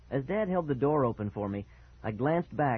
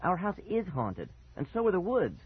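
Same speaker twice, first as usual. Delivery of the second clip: insistent; 7 semitones higher than usual. A narrator's reading. Boy insisting to parents the house is haunted, insistent. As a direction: insist on it, pitched up. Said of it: "I insisted".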